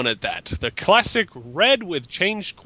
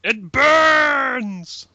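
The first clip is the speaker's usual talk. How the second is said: high cry of fake burning pain; hammed-up scream of burning; mock agony shout; 9 semitones higher, 5 dB louder than usual. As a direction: yell it like you're on fire. Dramatic intro of guest.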